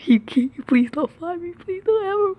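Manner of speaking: scared pleading. Real, not acted. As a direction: pitch climbing in rising panic. Begging, seeker approaching.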